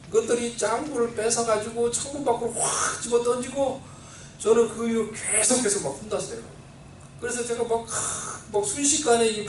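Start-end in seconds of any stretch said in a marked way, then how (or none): none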